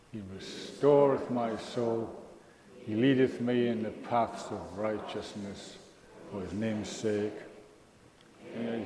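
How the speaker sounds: slow, measured psalm reading; solemn scripture reading. Acted. Preacher leading the congregation through Psalm twenty-three, slow and solemn. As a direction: let each line settle before the next.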